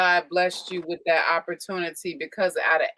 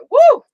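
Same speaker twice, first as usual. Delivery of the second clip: excited whoop; 13 dB louder than usual. Real, not acted. Host cheering after tribute.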